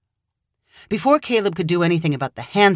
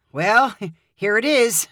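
slow, awkward laugh through the words; nervous laugh; 4 semitones higher, slower than usual. Acted. Caleb showing Hannah his freezing apartment, nervous laugh. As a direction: cover the embarrassment with a laugh.

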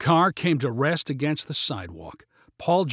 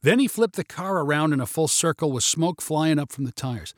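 ordinary delivery

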